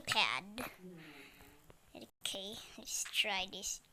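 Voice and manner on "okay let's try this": slow whispered focus; careful whispered try. Real, not acted. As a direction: whisper it slowly, concentrating. Whispering while trying.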